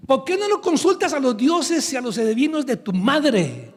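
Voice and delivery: a prophet scolding the son of Jezabel; angry prophet. Acted. Profeta enojado regaña al rey hijo de Jezabel, indignado. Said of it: "se enojó el profeta".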